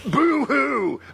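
high, wailing mock boo-hoo; fake crying. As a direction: blubber like a hammy crybaby. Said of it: "Alex Jones crying over the Epstein files".

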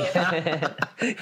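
mock polite giggle; little giggle. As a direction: soften it with a tiny laugh. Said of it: "giving a little, little giggle".

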